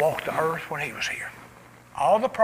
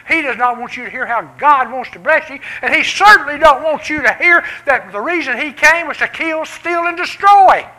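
preaching at full force; 7 semitones higher, 10 dB louder than usual. Real, not acted. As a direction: preach it up to the rafters. Preaching builds to climax.

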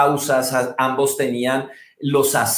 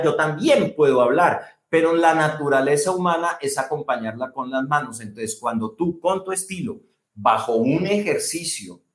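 normal talking voice

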